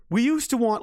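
ordinary delivery

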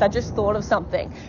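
normal talking voice

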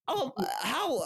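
mocking the Idaho dad in a high grating American accent. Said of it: "I can't even do his voice".

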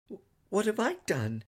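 put-on high, puzzled little boy; perplexed child. A narrator's reading. Son asks father what he did, perplexed. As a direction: ask it genuinely confused.

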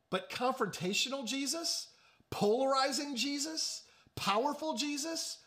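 rising preacher's build on confrontational, polarizing, powerful; building emphasis. Acted. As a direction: climb in pitch through the list, stressing each adjective. Preacher builds list of Jesus titles.

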